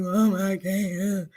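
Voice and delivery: lightly acted weepy wail; retold crying. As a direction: imitate crying out to a parent. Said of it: "in the closet crying".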